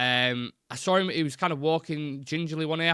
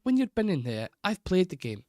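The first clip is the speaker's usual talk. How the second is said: hammed-up Scottish pundit with the stress on played; Graham Souness accent; 6 semitones higher than usual. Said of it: "I give it a go, but it's not really good".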